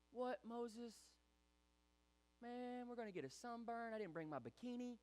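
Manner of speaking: high, soft comic whine; mock griping. Israelites griping to Moses at the sea, mock whiny. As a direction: complain like a petty vacationer, voice up.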